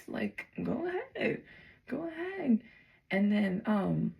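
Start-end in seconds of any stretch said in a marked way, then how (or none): 0.1-1.4 taunting dare. Retold or imitated. Daring the strangers to stab. 1.9-2.6 taunting dare, falling. Retold or imitated. Daring the strangers to stab.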